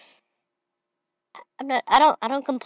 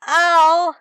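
much higher and louder yelp of pain; 11 semitones higher, 10 dB louder than usual. Retold or imitated. Gamer voices getting shot, sharp yelp. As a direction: yelp as if just hit. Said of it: "I'm just like".

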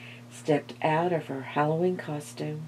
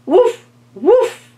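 dog bark; 14 semitones higher, 11 dB louder than usual. A narrator's reading. Dog barking imitated.

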